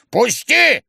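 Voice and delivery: loud, high demand; old man's impatient shout. A narrator's reading. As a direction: yell to be let through. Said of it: "кричал старик".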